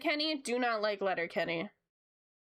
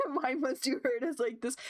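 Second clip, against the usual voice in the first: ordinary talk, nothing marked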